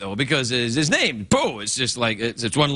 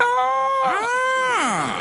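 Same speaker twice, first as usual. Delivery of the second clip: raven squawk. Acted. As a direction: screech like the raven. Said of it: "you want to do the thing?".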